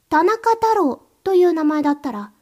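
ordinary talk, nothing marked

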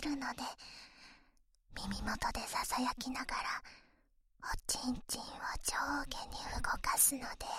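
loud close-mic whisper in the ear; right in your ear. Acted. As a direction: breathe the words right into the ear.

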